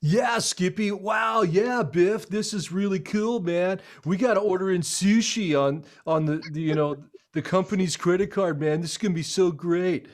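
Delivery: put-on hyped frat-boy chatter; clueless young ad guys. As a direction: gush like rookie admen thrilled with their first campaign. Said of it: "and they're like".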